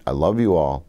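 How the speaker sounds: ordinary delivery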